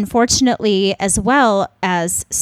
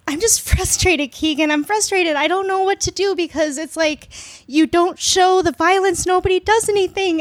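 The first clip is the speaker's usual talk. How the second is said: high-pitched, tearful frustration; frustrated, starting to cry; 6 semitones higher than usual. Real, not acted. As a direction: plead helplessly as real tears come. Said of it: "I'm crying".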